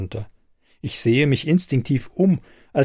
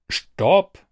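sharp, louder call to halt; called out stop; 7 semitones higher, 4 dB louder than usual. A narrator's reading. Isabel stopping Kate with a raised call. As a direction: cut her off with a shout. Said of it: "rufe ich".

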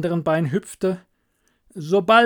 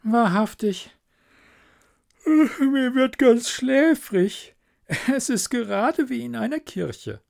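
drowsy admiring wife; 10 semitones higher, 3 dB louder than usual. Acted. Wife lulled by miller's sermon, drowsily admiring. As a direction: stretch it out high, as if getting drowsy. Wife says she grows sleepy.

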